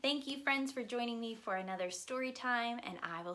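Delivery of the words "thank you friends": usual speaking voice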